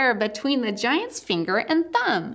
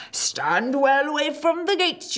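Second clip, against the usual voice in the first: humble giant voice; 6 semitones higher than usual. Acted. Giant character voice.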